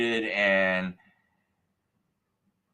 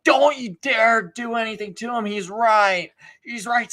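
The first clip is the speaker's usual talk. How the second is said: high, raised-voice retold warning; protective yelling; 9 semitones higher, 4 dB louder than usual. Older lady defending him from the crowd, protective yelling. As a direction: imitate a woman fiercely warning people off.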